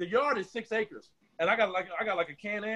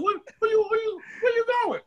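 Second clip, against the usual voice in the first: calling out; 11 semitones higher, 3 dB louder than usual. Retold or imitated. Chasing the truck, calling out.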